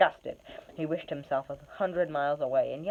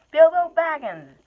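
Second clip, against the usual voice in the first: high, loud, stammering answer; a captured hobbit blurting out his name; loud, high, stumbling reply in fear; frightened hobbit; 12 semitones higher, 10 dB louder than usual. Acted. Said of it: "said poor Bilbo".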